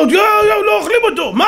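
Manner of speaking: very high, hammed-up shout of disbelief; astonished outcry. Servants alarming the king about the lions, astonished outcry. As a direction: cry out in mock shock, voice shooting up.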